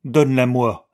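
ordinary delivery, nothing marked

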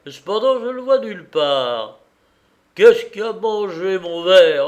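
loud, high cry of alarm over a lost glass; indignant drunken reveller. Acted. Drunk reveller hunting for a missing glass, indignant. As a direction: raise your voice, tipsy and outraged.

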